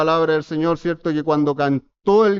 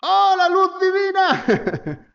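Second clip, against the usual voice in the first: high, slow mocking shout of the divine light; mocking call; 14 semitones higher, much slower than usual. Friend yelling mockingly at the preacher on his way. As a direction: tease him from afar, drawn out high. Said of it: "me gritaban".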